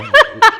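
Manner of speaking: short, very high laugh; high giggle. Real, not acted. Recalling his childhood threat to fight back, a quick high giggle. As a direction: giggle mid-sentence.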